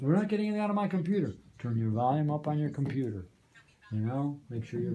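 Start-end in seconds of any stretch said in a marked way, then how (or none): 0.0-1.3 fast, high-pitched complaining visitor; mock complaint. Trainer voicing people who come in with laptops, mock complaint. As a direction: rattle it off as a flustered user's gripe.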